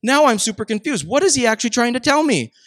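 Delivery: baffled reader. Retold or imitated. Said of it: "And you're like".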